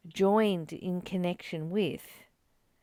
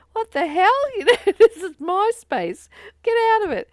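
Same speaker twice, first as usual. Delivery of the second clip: higher and louder mock indignant outburst, laughing; mock outrage; 13 semitones higher, 11 dB louder than usual. Mock outraged yell at a stranger crowding her in the supermarket. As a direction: ham up offended Aussie personal space. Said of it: "and we go".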